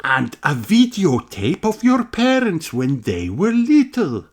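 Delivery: high, slow, kindly teacher voice; a teacher explaining to her class slowly; Madame Gazelle voice. Acted. Teacher telling children about the time capsule, high and slow.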